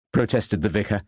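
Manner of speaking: plain reading voice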